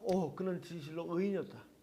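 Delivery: hushed, soft realization; imitating a soldier's quiet awe; involuntary awed confession. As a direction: let it slip out softly, as if unawares. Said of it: "자기도 모르게 그 입에서 나왔습니다".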